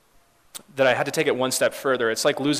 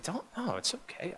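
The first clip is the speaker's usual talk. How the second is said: much softer, higher 'it's okay'; soft reassurance; 10 semitones higher, 11 dB quieter than usual. Retold or imitated. Grieving mother reassuring the pastor, soft and high. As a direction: ease the apology away softly. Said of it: "And they said".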